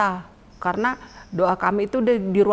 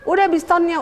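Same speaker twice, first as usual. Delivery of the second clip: high-pitched mimicked check-up; imitated hostess question; 9 semitones higher, 3 dB louder than usual. Hostess checks on morning devotions, high imitated question.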